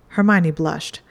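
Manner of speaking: usual speaking voice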